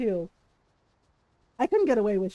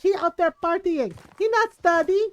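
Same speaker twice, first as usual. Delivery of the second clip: rising higher and louder, mock indignation; imitating mom's angry scolding; 5 semitones higher, 5 dB louder than usual. Mom complaining to her eldest about the partying son, indignant.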